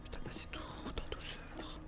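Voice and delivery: whispering. Real, not acted. Sneaking in a stealth game.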